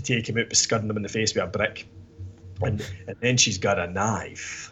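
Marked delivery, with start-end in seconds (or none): 2.6-4.7 a lightly voiced action-hero line, low and quiet; slow, softer tough-guy delivery, barely put on; a hint of a movie hard man, said slow and soft; Bruce Willis. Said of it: "that was supposed to be Bruce Willis in Die Hard".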